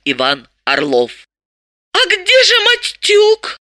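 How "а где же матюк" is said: very high, slow, bewildered squeak; dazed, drawn-out high question; babbling in dismay. A narrator's reading. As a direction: falter it out in a tiny high voice. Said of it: "пятясь пролепетала Далила".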